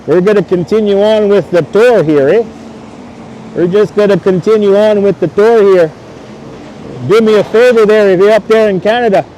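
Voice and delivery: light mock Canadian accent asking for a Molson. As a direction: do a Canadian beer-ordering accent for the chat. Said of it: "pretty good accent there, eh?".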